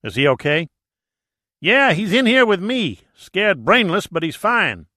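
a hint of a Texas drawl. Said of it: "his Texas drawl".

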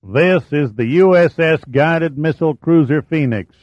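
Texas drawl. Acted. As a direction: drawl it out like a Texan skipper. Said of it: "rasped out ... in a pronounced Texas drawl".

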